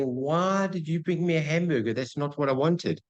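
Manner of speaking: high, upset customer complaint; freaking out. Retold or imitated. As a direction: act out a customer losing it over the wrong order. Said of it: "You're, like, freaking out".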